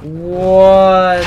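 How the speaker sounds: real surprise at the screen; startled what. Real, not acted. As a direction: caught off guard by a kill. Sudden death in game.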